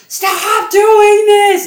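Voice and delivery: loud, high, drawn-out yell; hammed-up screaming at the kids; mock parental yell. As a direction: imitate an exasperated parent bellowing, stretching every word. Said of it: "your ultimate thing, though, is just".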